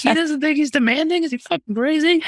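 high-pitched mock disbelief; incredulous. Team reacting to his denial, incredulous.

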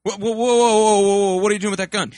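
loud, high alarmed protest. Acted. Son startled by the gun, loud and high. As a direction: back off in fright.

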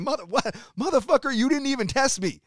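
mock outrage. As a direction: blow up at the bill like it's happening now. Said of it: "I'm like".

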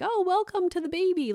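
high, sing-song congratulations; mock cutesy greeting. Baby card greeting new arrival, mock high cooing. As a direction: coo it like a sappy card.

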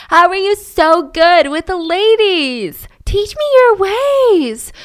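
high, slow, awestruck gushing; starstruck fanboy voice. Retold or imitated. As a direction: gush like a starstruck fan. Kenneth voiced in awe.

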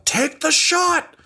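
very high, drawn-out yelled command; [name]'s urgent yell. Acted. Game master as crew boss ordering a shot, high strained yell. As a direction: yell it over the chaos, stretching each word. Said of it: "you hear [name] just yell".

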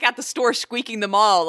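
ordinary talk

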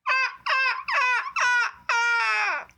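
very high bird-cry imitation; imitating a seagull's call. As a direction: squawk like a distant bird. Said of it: "a distance, it goes".

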